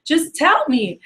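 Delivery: slow, high, insistent question; exasperated insistence. Retold or imitated. Manager pressing a stubborn rep on the phone, exasperated. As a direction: spell it out in retold exasperation. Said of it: "He's fighting me on the phone".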